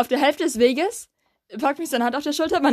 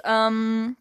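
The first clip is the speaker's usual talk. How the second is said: usual speaking voice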